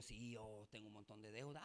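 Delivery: low, soft reenacted admission; hushed, low-pitched quote; subdued retold confession. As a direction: own up to it quietly, as if retelling a chat. Retold private chat about debts.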